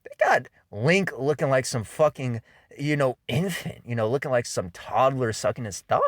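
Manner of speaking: ordinary talk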